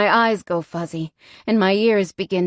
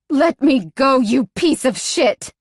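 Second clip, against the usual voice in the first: desperate yell; 5 semitones higher than usual. A narrator's reading. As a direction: yell it in raw panic. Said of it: "I'm desperate now, and I can hear it in my voice".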